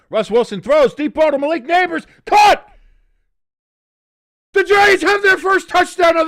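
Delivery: screaming play-by-play, very high and loud; touchdown call. Pretend. Streamer calling a Giants touchdown, shrieking announcer yell. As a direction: call the catch like a radio announcer losing it.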